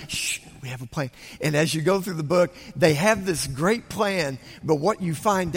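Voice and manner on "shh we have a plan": quick soft shush; hushed. Retold or imitated. Friends hushing the little one in the boat, quick and soft. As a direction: hurry to hush him up. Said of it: "little one".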